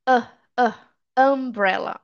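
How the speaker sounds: short separate "uh, uh", then "umbrella". Shown as a demonstration. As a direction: isolate "uh", then put it into "umbrella". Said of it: "o som da letra U".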